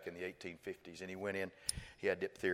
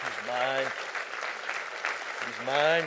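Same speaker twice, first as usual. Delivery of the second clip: rising proclamation; 5 semitones higher, 9 dB louder, much slower than usual. Real, not acted. Preacher proclaiming to the congregation, building loud and high. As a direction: pitch and volume climbing with each repeat.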